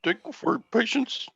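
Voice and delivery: put-on high-pitched, polite Indian-accented agent voice; imitated Indian accent. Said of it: "you could do that accent".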